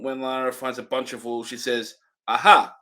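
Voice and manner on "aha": normal talking voice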